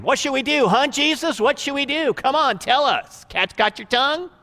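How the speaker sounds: high, loud, sneering goading of the accusers; mocking taunt. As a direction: needle him like a smug bully. Pharisees goading Jesus, voiced mockingly.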